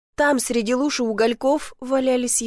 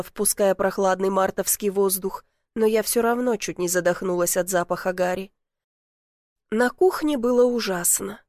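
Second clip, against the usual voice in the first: plain reading voice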